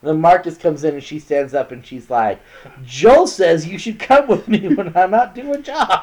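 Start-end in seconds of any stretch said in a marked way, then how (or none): 2.8-6.0 high, loud mock girlfriend; hammed-up bossy girl; demanding girlfriend. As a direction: lay down the law to the boyfriend. Girl demands boyfriend join her.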